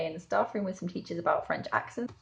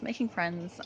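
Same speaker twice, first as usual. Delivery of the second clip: normal talking voice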